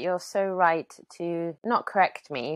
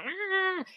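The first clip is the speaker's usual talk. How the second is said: imitated toddler whine; 4 dB quieter than usual. Daughter Isla getting aggy.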